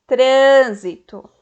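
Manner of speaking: "trânsito" with TRÂN loud and drawn out; stressed tonic syllable. Shown as a demonstration. Teacher demonstrating proparoxítonas, "trânsito" stressed loud on TRÂN. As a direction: hit TRÂN in "trânsito" hard and slowly. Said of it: "todas as sílabas tônicas possuem acento".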